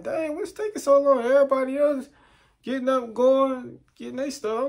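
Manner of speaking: high-pitched mock-impatient complaint; impatient whining. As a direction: whine high about being kept waiting. Impatient complaint in his head.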